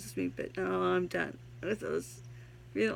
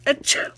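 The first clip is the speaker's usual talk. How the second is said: fake sneeze; 10 dB louder than usual. Sneeze gag inside poem.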